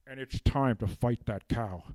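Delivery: ordinary delivery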